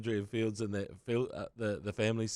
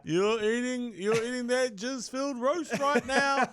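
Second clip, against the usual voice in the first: high, loud mock jeer; hammed-up taunting voice; jeering heckler; 12 semitones higher, 5 dB louder than usual. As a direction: taunt them like a drunk fan. Mocking taunt back at Kiwis.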